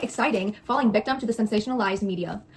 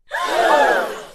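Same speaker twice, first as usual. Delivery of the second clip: sharp high gasp; 6 dB louder than usual. Real, not acted. Sudden dramatic reaction mid-talk.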